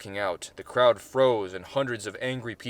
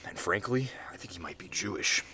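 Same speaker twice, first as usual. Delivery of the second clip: whispered, glancing round for listeners; secretive whisper; 4 semitones lower, 7 dB quieter than usual. Acted. Chud confides a secret, whispering. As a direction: whisper it so nobody nearby hears. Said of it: "looking over his shoulder, making sure there's no one".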